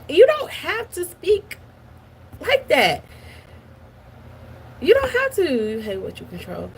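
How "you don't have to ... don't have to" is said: high exasperated plea; pleading, high and emphatic. Real, not acted. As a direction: beg them to stop, voice up high.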